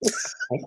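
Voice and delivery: quiet squeaky giggle; a little giggle; soft high giggle. Real, not acted. Laughs at partner.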